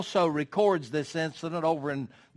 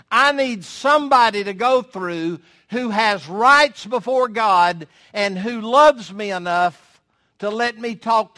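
raised, driving preacher voice; preaching; 4 semitones higher, 7 dB louder than usual. Acted. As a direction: preach it out to the whole church. Preaching to congregation.

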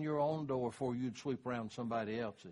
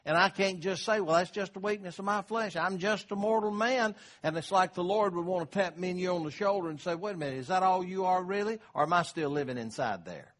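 rising, louder, higher preacher's build; preaching; 4 semitones higher, 4 dB louder than usual. Acted. Pastor urging the congregation before communion, preaching hard. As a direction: climb to full pulpit force.

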